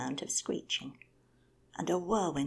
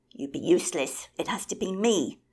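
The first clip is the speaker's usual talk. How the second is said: shouted insistence; loud squabbling claim; 3 semitones higher, 13 dB louder than usual. Acted. Bird shouting down rivals in the row, insistent. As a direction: shout over the others. Birds quarrelling.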